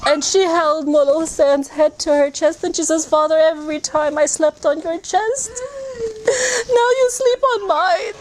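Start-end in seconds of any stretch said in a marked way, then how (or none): 3.1-8.2 high, sobbing cry to her father; crying out in grief. Real, not acted. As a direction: wail to the father through tears. Daughter cries to father's head.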